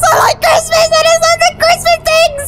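high, bright-eyed gushing; gleeful Christmas chirp. Real, not acted. Gamer to her viewers, gushing high about Christmas.

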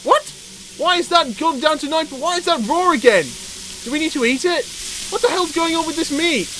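high-pitched, incredulous questioning; baffled outrage. Real, not acted. Gamer seeing his meat turn raw, high baffled outrage.

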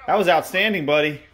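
normal talking voice

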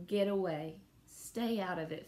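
ordinary delivery

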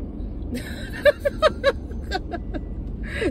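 high unvoiced laugh breaking into a cry; a tearful half-laugh that cracks; laughing through tears; laugh turning to crying. Real, not acted. Emotional plea to God.

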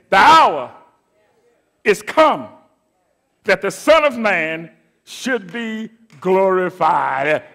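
loud ringing preacher's cry on hour, then slowing on glorified; proclaimed scripture. Acted. Preacher urging the congregation to see this, loud proclaiming cry. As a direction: proclaim it to the whole church, rising to the glory. Preacher quotes Jesus, climactic take.

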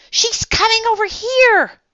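put-on very high, loud girl's voice; alarmed, high-pitched outburst; urgent warning. A narrator's reading. Girl warns friends of approaching woman.

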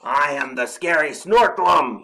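big, loud monster growl; growled boast. A narrator's reading. Snorklum threatening Mole, a loud growl. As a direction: announce yourself like a scary beast. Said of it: "he growled".

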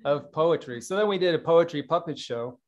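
usual speaking voice

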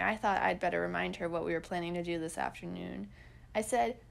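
plain reading voice

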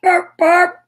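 high, loud toy-car honks; car horn imitation. A narrator's reading. Naughty's car drives out of the square, honking. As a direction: toot like a little car horn. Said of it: "went Naughty's little car".

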